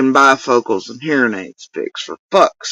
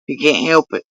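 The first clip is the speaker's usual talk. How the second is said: normal talking voice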